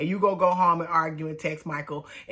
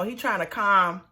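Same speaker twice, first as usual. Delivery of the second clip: ordinary talk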